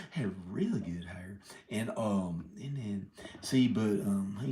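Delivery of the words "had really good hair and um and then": unvoiced breathy whisper after laughing. Real, not acted. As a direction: whisper it under the giggles. Whispering aside while laughing.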